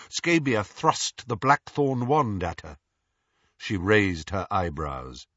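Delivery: plain reading voice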